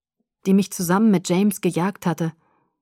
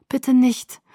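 mildly gasped plea, higher pitched; keuchend; 6 semitones higher than usual. A narrator's reading. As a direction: gasp it out pleadingly. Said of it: "keuchte ich". (leicht gekeuchtes Flehen)

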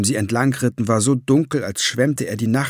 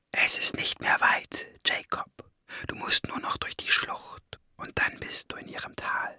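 very soft, voiceless whisper; hushed, whispered encouragement; 9 dB quieter than usual. A narrator's reading. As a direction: urge him on under your breath. Inner urging, narrated in whisper.

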